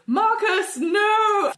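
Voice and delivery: high, loud despairing cry; anguished shout. Acted. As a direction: scream his name as he dies. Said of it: "Bertrand go".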